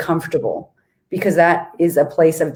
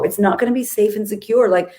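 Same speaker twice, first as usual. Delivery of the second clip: normal talking voice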